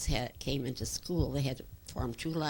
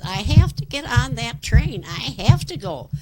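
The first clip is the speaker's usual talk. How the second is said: much higher, loud and insistent; mother's urgent insistence; 9 semitones higher, 13 dB louder than usual. Retold or imitated. Mother begging to get on that train, loud urgent insistence. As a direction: raise the voice high, demanding to board. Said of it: "because she says".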